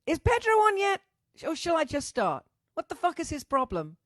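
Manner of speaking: high, loud exasperated question; impatient call-out. Real, not acted. Host waiting on a late friend, loud impatient demand.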